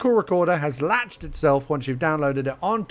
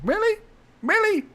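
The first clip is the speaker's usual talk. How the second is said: incredulous. Real, not acted. Sarcastic disbelief.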